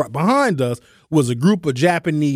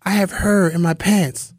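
put-on a touch higher, accented broken English; imitated server accent; 5 semitones higher than usual. Comic mimics waitress explaining lost ticket in accent. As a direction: say it earnestly like the waitress. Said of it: "She said".

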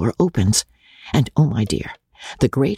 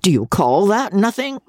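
coarse squire, pitched up; a lightly voiced country baronet; old baronet; 8 semitones higher than usual. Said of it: "He pronounced it nothink".

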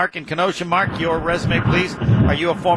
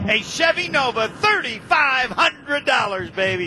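triumphant reveal; 6 semitones higher than usual. Real, not acted. Host reveals winning answer.

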